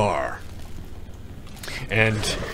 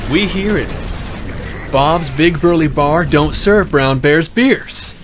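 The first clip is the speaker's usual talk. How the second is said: slow and deliberate, a touch higher; stern slow rule; 4 semitones higher, slower than usual. Acted. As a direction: lay down the law one word at a time. Bartender repeats the rule firmly.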